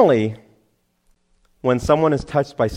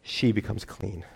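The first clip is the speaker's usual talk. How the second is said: soft, lowered voice, moved; real choked-up hush; getting emotional; 3 semitones lower, 9 dB quieter, faster than usual. Real, not acted. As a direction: hold back real emotion, speaking softly. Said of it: "sorry, I'm getting emotional".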